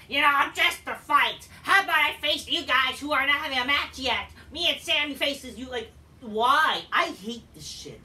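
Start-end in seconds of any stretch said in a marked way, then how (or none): 0.1-5.7 loud, raised mock wrestler challenge; mock Ron promo. Vlogger mocking Ron's challenge to a match, loud and cocky. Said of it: "Ron's like".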